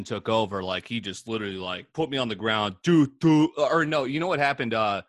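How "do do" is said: loud, high punch noises; imitated punch sounds. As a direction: act out the blows with your voice.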